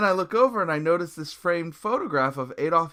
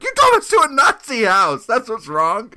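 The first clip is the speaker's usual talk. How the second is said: high, loud mock fury; exasperated outburst; 9 semitones higher, 10 dB louder than usual. Bandmate answers singer's "what's wrong", exasperated outburst. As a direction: fire it back in comic outrage.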